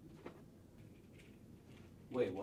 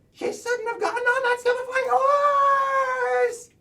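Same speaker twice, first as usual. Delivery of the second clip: louder and higher, anguished; bigger take; 12 semitones higher, 13 dB louder than usual. Acted. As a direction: go bigger, throw it out. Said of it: "Try again".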